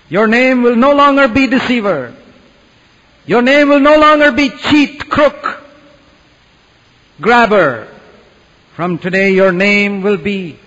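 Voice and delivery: high, loud, ringing proclamation; preaching at full force. Acted. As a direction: proclaim it like a promise from the pulpit. Preaching climax.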